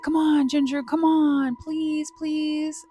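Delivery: high-pitched imitation of begging cousins; mock pleading. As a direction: whine it like pestering kids. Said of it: "they were like".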